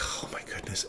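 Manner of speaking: breathless whisper of relief; stunned whisper as the girl's skin turns pink. Retold or imitated. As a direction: let it out under your breath. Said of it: "I'm like".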